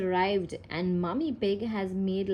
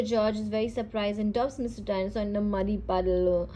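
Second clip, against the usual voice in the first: plain reading voice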